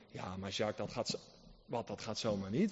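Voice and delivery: low, soft mock objector voice; a lightly voiced skeptic in the pews; objecting churchgoer. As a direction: drop low and doubtful as a listener protesting.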